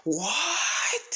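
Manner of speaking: high startled scream. Acted. Ether screaming at the doctor in disbelief, high shocked scream. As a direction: shriek it out in shock. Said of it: "And instantly screaming".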